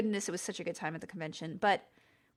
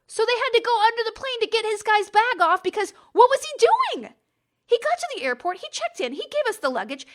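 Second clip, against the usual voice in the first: higher, louder, riled-up disbelief; incredulous; 7 semitones higher, 5 dB louder than usual. Real, not acted. Telling viewers about the vanished passenger, higher and louder in disbelief. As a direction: rattle off the questions in mounting amazement.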